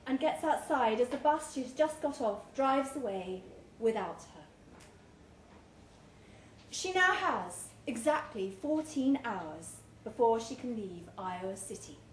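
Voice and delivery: usual speaking voice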